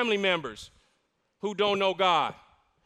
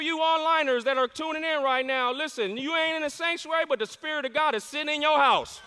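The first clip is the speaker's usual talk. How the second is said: full-force preaching; 6 semitones higher than usual. Real, not acted. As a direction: drive it home to the online viewers. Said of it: "preaching climax to online viewers".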